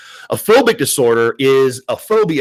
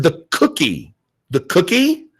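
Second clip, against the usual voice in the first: pointed, rising emphasis on cookie; teacher pressing Nicole to get the code word, pointed rising hint; insistent hint; 5 semitones higher than usual. Retold or imitated.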